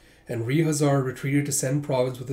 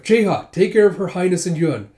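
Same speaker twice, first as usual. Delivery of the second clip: higher and louder instruction; urgent order; 5 semitones higher, 8 dB louder than usual. Acted. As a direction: bark it over the noise. Ordering comrade mid-battle.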